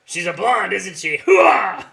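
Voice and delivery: loud colonel impression; loud gung-ho soldier. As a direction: rasp it like Frank Slade. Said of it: "Colonel what? Frank something?".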